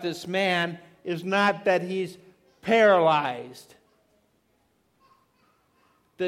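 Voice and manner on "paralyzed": paralyzed said very slowly and much louder; stress on paralyzed. Real, not acted. Preacher to congregation, slow loud punch on paralyzed.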